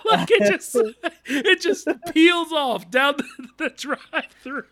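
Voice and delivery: high, laughing through the words; can barely get it out for laughing; laughing while telling. Real, not acted.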